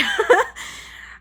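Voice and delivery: high tearful laugh; shaky sigh. Real, not acted. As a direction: laugh to fight off tears. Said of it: "I'm trying not to cry".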